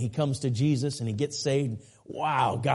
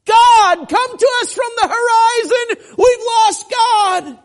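very high, very loud desperate call; crying out; 12 dB louder, slower than usual. Acted. Man up in the trees calling to the horizon, crying out. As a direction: holler to a lost God, drawing out the last words. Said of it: "he's crying out".

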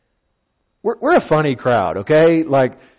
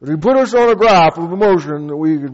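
pious pontificating colleague; mock a self-important pastor; lightly voiced preachy pastor; pompous pastor; 4 semitones higher, 5 dB louder than usual. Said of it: "there was a".

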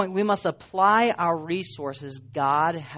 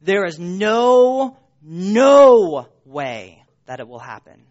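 louder, slow insistence on "no way"; emphatic loud no; 9 dB louder, slower than usual. Real, not acted. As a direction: hammer it home that it cannot happen. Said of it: "emphatic negative".

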